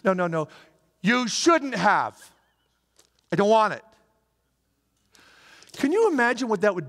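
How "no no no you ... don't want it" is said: blunt refusal. Pretend. Giver's son hears the blunt refusal.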